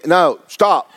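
normal talking voice

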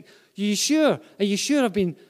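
high, loud, anxious questioning; needing reassurance. Acted. Doubting believer asking God for reassurance, anxious and insistent. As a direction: plead to be told it is sure. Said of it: "some people need a lot of reassurance".

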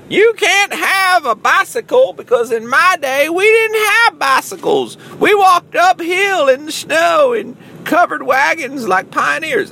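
very high, slow, hammed-up old codger voice; mock old-timer. Old-timer scolding kid about bicycles, hammed-up voice. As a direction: lecture like a cartoon old-timer, loud and drawn out.

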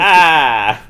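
a feeble imitated bear growl, short and loud; weak mock growl. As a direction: growl like a bear who can't really growl. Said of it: "the least convincing growl I've ever seen".

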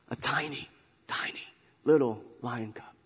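hushed emphasis on tiny; stress on tiny. Shown as a demonstration. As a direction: make tiny sound tiny, hushed. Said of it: "I emphasize tiny".

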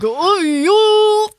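high squeal of flustered delight; swooning cop. Retold or imitated. Cops ogling the frisk, retold as a high, loud squeal. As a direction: squeak it like a smitten cartoon. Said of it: "and it's like".

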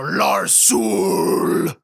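low, drawn-out villain name; mock dark-lord rumble; metal growl. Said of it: "That sounds fucking metal".

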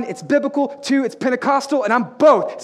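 ordinary talk

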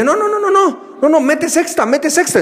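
very high, frantic stream of no; panicked friend. Retold or imitated. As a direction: yell at the driver in alarm. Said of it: "tu amigo de lado te dice".

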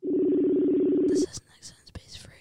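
hushed, slow whisper. Real, not acted. Telling listeners whose best friend is being called, whispered. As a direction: whisper it as an aside. Whispered aside while call connects.

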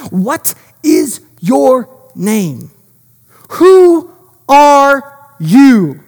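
word by word, rising to a loud WHO ARE; slow, emphatic demand. Acted. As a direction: demand it slowly, each word on its own. Angel confronts Jacob with question.

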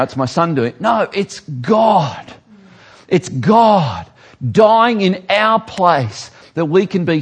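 loud, high, impassioned sermon voice; preaching at full force. Acted. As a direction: drive it home to the congregation. Preacher builds to passionate climax.

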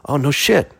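"Oh, no shit" with a rise on "shit", interested; pleasantly surprised. Retold or imitated. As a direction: perk up, impressed.

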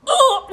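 high, laughing groan of being caught out; a gut-punch "ugh" through a laugh; pained groan. Retold or imitated. Reacting to a stranger's truth at a meeting, high laughing groan.

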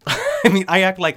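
bursts out laughing, loud and high. Real, not acted. Laughing at Ina's odd sign-off, loud and high. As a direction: laugh hard before speaking.

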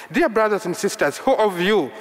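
normal talking voice

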